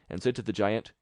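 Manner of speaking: usual speaking voice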